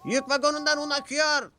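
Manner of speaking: high call across the yard. A narrator's reading. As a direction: shout the warning from afar. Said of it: "diye seslendi James".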